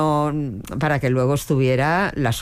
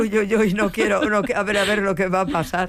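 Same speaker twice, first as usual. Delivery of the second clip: higher, hurried little mock panic; mock nervous dread; 6 semitones higher, faster than usual. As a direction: play the cringing worry of seeing yourself on TV, lightly. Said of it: "Y dices".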